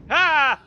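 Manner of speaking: sharp startled "Ha!"; a sudden loud shout of surprise. Real, not acted. Sudden burst at a scare.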